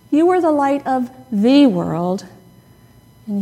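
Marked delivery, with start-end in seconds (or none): 0.1-2.3 second "the" punched as "thee" before "world"; stress on second THE. Shown as a demonstration. Preacher recalling a childhood word game, stress on last "the". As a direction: lean on the "the" just before "world". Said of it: "and so on".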